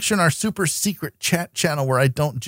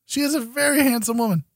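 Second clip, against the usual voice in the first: mock posh voice; 11 semitones higher, faster than usual.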